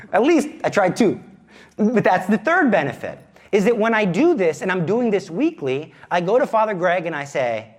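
ordinary delivery

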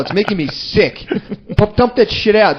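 retold coffee purist's disgusted outburst; mock offended outrage. Coffee drinkers appalled by flavored creamer, mock offended. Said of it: "They would get offended".

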